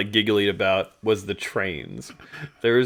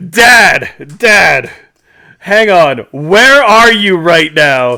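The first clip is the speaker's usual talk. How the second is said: loud, higher call to stop him; interrupting dad; 6 semitones higher, 12 dB louder, much slower than usual. Retold or imitated. Son stopping his dad mid-rant, loud and firm. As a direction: cut in loudly to halt the rant. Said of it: "and I go".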